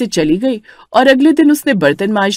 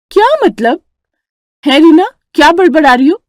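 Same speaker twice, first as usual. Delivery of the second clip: sharp, much higher indignant elder sister-in-law; 8 semitones higher, 5 dB louder than usual. Acted. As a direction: bristle at the accusation. Vimla भड़कती है. (ऊँची आवाज़ में भड़कती जेठानी)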